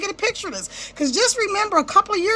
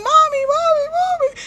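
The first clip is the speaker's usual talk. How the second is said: put-on high, far child cry of mommy; kids screaming for mommy; 12 semitones higher than usual. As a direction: wail it like a scared little kid. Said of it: "Your kids are screaming and crying".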